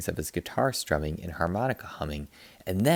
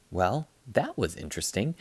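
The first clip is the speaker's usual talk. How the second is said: ordinary delivery, nothing marked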